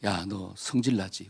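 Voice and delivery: low, soft and slow, an angel speaking down; gentle angel. Retold or imitated. Lightly voiced angel asking the dreaming man. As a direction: hush it like a heavenly messenger. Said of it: "천사가 뭐라고 이야기하느냐".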